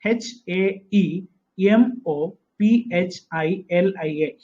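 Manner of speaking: each letter said slowly and separately; teacher spelling out the word slowly. Shown as a demonstration. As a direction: dictate letter by letter. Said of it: "haemophilia".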